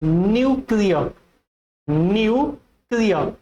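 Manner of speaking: "न्यूक्लियर" slowly as NYOO-klee-a; correct pronunciation. Shown as a demonstration. Teacher models the correct word, slowly pronounced. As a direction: stretch "न्यूक्लियर" with a Y glide after N. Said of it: "the correct pronunciation is".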